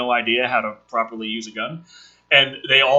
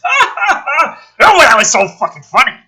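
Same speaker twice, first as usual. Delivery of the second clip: loud, much higher mocking ha ha ha; sarcastic fake laugh; 11 semitones higher, 10 dB louder than usual. Wife mocking the grinning loonies, sarcastic laugh. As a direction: laugh at them with pure scorn.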